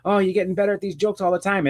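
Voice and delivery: high-pitched gleeful taunting; Joker. Retold or imitated. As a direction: imitate a villain tickled by his own cruel joke. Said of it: "he starts laughing maniacally".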